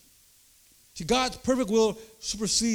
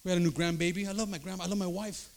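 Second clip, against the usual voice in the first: normal talking voice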